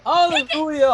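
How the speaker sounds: high, loud mock hymn shout; joyful cry. Real, not acted. Reader cheering Spike's revival, loud and high. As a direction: belt it like a gospel choir. Echoing the rejoicing.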